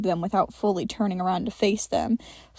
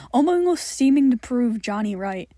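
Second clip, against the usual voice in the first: plain reading voice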